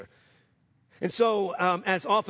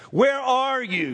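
high, slow calling out; God calling out to Adam, slow and high; 7 semitones higher, 3 dB louder, slower than usual. Retold or imitated. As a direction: call it out as if searching. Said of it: "to Adam".

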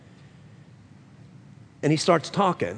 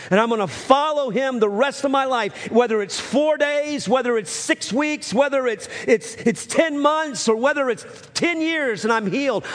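higher voice building with conviction; fervent vow; 5 semitones higher than usual. Retold or imitated. Sick man pledging his life to Jesus, fervent building vow. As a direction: pile up the promises, climbing in pitch as an acted vow. Retelling dying man's conversion vow.

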